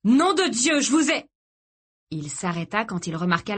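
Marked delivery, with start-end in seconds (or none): 0.0-1.3 put-on high, raised-voice bark at children; furious father calling out; angry shout. A narrator's reading. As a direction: shout it, beside yourself. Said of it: "leur cria-t-il".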